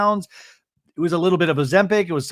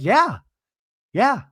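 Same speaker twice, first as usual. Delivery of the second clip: high-pitched imitated agreement; short high retort, repeated; mock emphatic yeah; 8 semitones higher, 4 dB louder than usual. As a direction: toss back a high "yeah". Imitating a defiant answer.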